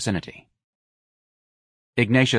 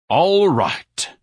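high, loud grand concession; said grandly; 9 semitones higher, 5 dB louder than usual. Acted. Ignatius yielding to the girls, said grandly. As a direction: concede like a king leaving court. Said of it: "Ignatius said grandly".

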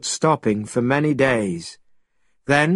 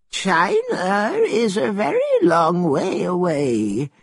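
put-on high, slow old man's voice; 5 semitones higher than usual. A narrator's reading. Old man doubting the traveller from China, old man's voice. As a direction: draw it out as an elderly villager. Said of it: "said the old man".

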